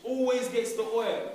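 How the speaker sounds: teacher pushing the class to say it with conviction; said like you mean it. Shown as a demonstration. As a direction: punch it out so they feel it. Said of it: "Say it like you mean it".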